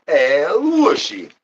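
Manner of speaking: slow, high, stretched call of El Wushy; drawn-out call to a pet; cat-calling sing-song. Acted. Calling the cat in a long high sing-song.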